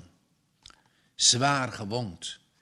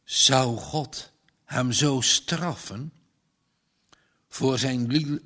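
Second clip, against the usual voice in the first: ordinary delivery, nothing marked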